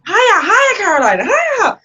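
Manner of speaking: high, loud drill-like calls; teacher urging loudly. Retold or imitated. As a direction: imitate a teacher pushing a student on. Teacher urging her during kick exercise.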